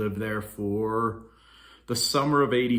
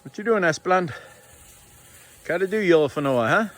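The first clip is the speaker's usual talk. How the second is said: high-pitched pet voice, a bit louder; puppy talk; 8 semitones higher, 6 dB louder than usual. Real, not acted. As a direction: coo up high at the dog. Talking to a puppy.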